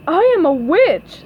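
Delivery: high, loud costume announcement; Pearl's voice. A narrator's reading. Pearl shows off her costume at the party, high and loud. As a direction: announce it with a big swooping pitch. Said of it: "said Pearl".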